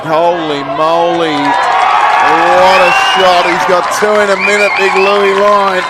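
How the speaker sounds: loud and stretched out in awe; a commentator amazed by a stunning kick; thrilled goal call. Real, not acted. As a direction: marvel at the shot at full voice.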